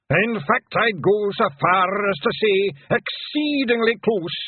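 loud, high Scots bard, drawn out; slow declaiming Scottish pixie; William the pixie. A narrator's reading. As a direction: roll the r's and stretch it grandly. William answers in bardic Scots.

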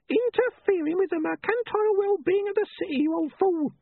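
very high, screechy nagging wife; high-pitched henpecking holler; scolding wife. A narrator's reading. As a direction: call your husband down in a piercing high voice. Wife scolds husband from below.

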